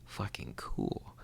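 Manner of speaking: hushed awed whisper. Retold or imitated. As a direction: whisper it like a secret. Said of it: "I'm just all like".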